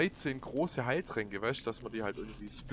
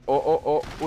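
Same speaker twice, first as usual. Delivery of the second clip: loud alarmed ohs; excited shouting; 3 semitones higher, 10 dB louder than usual. Real, not acted. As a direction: panicky loud cries. Kampf geht weiter. (aufgeregtes lautes Rufen)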